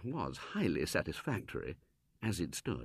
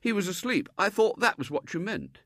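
a hint of higher, guileless boy; butter wouldn't melt; 11 semitones higher, 4 dB louder than usual. William innocently answering the Colonel, lightly voiced.

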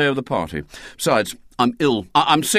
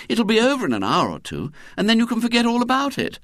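quick, higher, breezy reassurance; brisk mother; 13 semitones higher, faster than usual. A narrator's reading. Mother brushes off William's complaint, quick and breezy. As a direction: rattle it off lightly, like a dismissive mum.

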